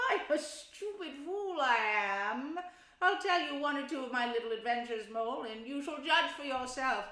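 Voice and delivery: loud, high bragging voice; boastful Toad. Acted. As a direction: brag with fake modesty.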